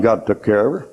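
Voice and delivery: normal talking voice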